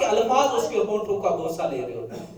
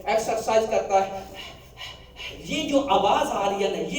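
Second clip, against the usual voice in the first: usual speaking voice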